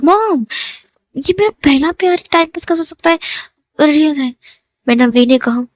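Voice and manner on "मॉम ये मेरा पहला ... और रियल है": indignant protest. Acted. Son insisting to his mother his first love is real, indignant protest. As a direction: protest in a raised pitch.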